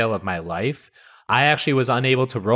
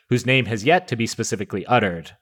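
usual speaking voice

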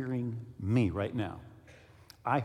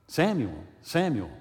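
gentler call, only slightly raised; restrained calling; 4 dB louder, slower than usual. Acted. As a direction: call the name with mild force, near speaking pitch. Last, more restrained call to Samuel.

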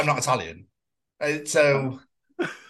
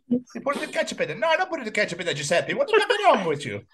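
higher-pitched comic Italian; Italian accent; 4 semitones higher than usual. Acted. Italian cooks quarrelling over ketchup in lasagna, mock Italian accent. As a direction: bicker in a hammed-up Italian accent. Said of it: "does an Italian accent".

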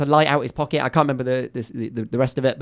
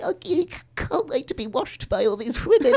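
high-pitched, giggly eagerness; mock eager punter; 11 semitones higher than usual. Festival-goer about to be washed, mock eager punter. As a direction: play the keen customer, breaking into a laugh. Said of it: "And you're like".

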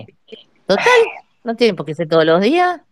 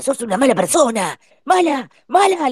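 mock scolding; 7 semitones higher than usual. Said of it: "Y el otro le decía".

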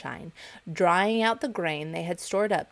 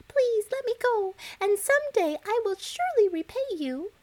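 begging mouse. Acted. The trapped mouse begs the lion, squeaky and high. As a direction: squeak out a desperate plea for mercy. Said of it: "begged the poor mouse".

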